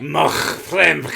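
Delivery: high Scouse with heavy throat scrape; phlegmy Liverpudlian accent. Acted. Exaggerating Scouse throat noise, second take.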